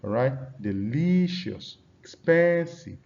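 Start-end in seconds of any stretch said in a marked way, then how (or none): 0.6-1.8 slow Delicious, de-LI-cious; Delicious with a long stressed middle syllable; stressed-syllable demo. Shown as a demonstration. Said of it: "practice with words like". 2.0-3.0 expensive as ex-PEN-sive, high and loud; stressed-syllable demo. Shown as a demonstration. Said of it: "practice with words like".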